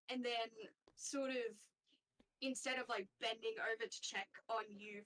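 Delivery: ordinary delivery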